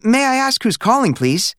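put-on high, careful boy's question; a boy asking properly on the phone; Stanley being very polite.

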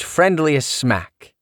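plain reading voice

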